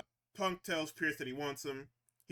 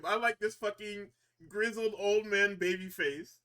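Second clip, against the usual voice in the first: ordinary delivery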